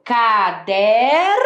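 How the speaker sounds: "K, der" as two drawn-out syllables. Shown as a demonstration. Teacher building a word for children, "K, der" syllable by syllable. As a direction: sound out "K, der" slowly, one syllable at a time. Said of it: "Agora eu quero der".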